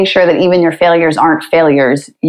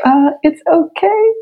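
gentle comfort; 10 semitones higher, slower than usual. Retold or imitated. Husband comforts his crying wife, gently reassuring. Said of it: "Like, gentle pats on the back".

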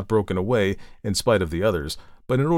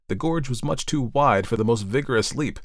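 ordinary delivery, nothing marked

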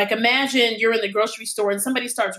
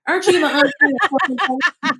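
excited fan quote; 6 semitones higher, 4 dB louder than usual. Retold or imitated. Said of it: "And he said".

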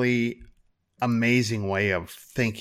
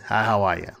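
put-on Staten Island accent; 4 dB louder than usual. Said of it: "Staten Island accent thing".

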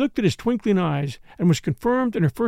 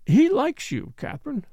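rather queer tone; 8 semitones higher than usual. A narrator's reading. Lennox after a pause to Catherine, rather queer tone. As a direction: say it oddly, with a lifted pitch. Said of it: "in a rather queer tone of voice".

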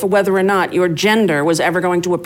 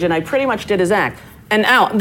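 usual speaking voice

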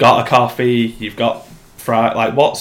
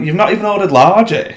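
higher, quick disbelieving jab; incredulous retort; 7 semitones higher, 4 dB louder, faster than usual. Retold or imitated. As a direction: fire back fast in disbelief. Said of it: "and I'm like".

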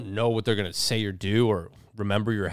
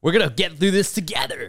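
higher, effortful rallying cheer; forced pep; 4 semitones higher, 3 dB louder than usual. Pretend. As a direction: summon energy for a pep talk. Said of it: "that took a lot of energy".

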